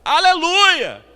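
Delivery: high, loud, drawn-out holler; long belted reply across a distance; shout from upstairs. Retold or imitated. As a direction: call down to someone far below. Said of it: "Aí o cara de lá".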